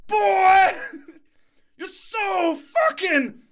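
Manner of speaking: put-on old investor blasting the consultant, yelling through laughter; monster rage. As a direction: roar at the young consultant like a monster. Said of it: "stands up like this monster".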